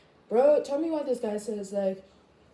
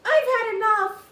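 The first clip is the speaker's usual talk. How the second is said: high, loud exasperated cry; imitating herself at breaking point, high and loud; fed-up outburst; 12 semitones higher, 9 dB louder than usual. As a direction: snap that you've had enough. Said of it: "she's just like".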